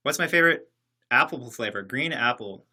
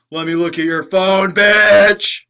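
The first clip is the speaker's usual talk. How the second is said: loud, higher mock jealous partner; controlling guy; 6 semitones higher, 13 dB louder, slower than usual. Streamer mocking an obsessive controlling partner, loud. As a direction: bark it like a possessive boyfriend. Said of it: "controlling ... kinda guy".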